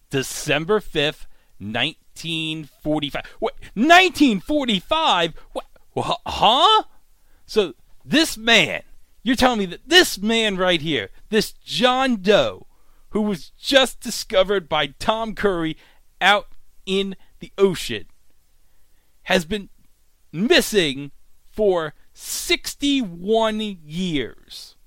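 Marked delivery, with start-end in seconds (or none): 3.4-6.8 high, loud stunned outburst; shocked disbelief. Real, not acted. Vlogger reacting to the missing-since-1945 reveal, stunned and loud. 7.5-24.8 high, slow, emphatic disbelief; incredulous recap. Real, not acted. Vlogger stressing the shocking timeline to viewers, incredulous.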